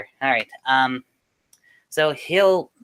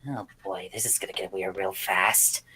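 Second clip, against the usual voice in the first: much softer and lower, to himself; inner thought; 8 semitones lower, 7 dB quieter than usual. Acted. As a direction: mutter it under your breath. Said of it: "he'll think to himself".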